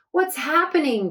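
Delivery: breathless startled gasp; a girl gasping as the walls lift. A narrator's reading. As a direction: gasp it out in alarm. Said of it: "gasped May".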